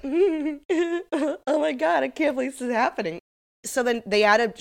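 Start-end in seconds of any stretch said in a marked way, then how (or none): 0.0-3.2 giddy, high, grinning gush; a high, too-bright grin in every word; manic smiling Raquel. Retold or imitated. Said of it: "Raquel is smiling manically".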